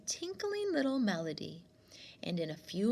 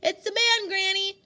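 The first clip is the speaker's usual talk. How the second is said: loud, higher call back to the house; 10 semitones higher, 9 dB louder than usual. Acted. Winnie calling back to her grandmother, loud reply. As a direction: call back across the grass. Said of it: "she called back".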